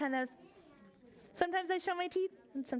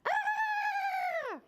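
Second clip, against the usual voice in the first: high, loud scream into a pot; 3 dB louder than usual. Acted. Mother screams into the soup pot, high and loud. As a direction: let out a big piercing scream. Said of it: "she screamed into the pot".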